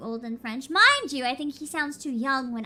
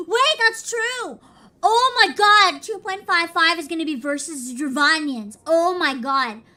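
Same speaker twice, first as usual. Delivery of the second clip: excited realization; 2 semitones higher, 9 dB louder, slower than usual. Real, not acted. Sudden realization about Dravanians.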